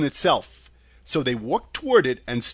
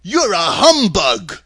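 loud, slow, higher dopey voice; goofy, loud hurt protest; grieved Scarecrow; 5 semitones higher, 9 dB louder, slower than usual. A narrator's reading. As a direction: complain as a wounded dimwit. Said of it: "in a grieved tone".

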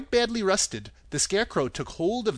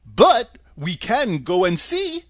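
Scarecrow voice; 4 semitones higher, 8 dB louder, slower than usual. Acted.

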